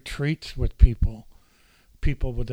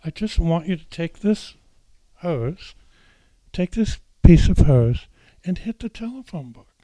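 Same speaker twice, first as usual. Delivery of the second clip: calm facilitator; 5 semitones higher, 6 dB louder than usual. Retold or imitated. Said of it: "She said".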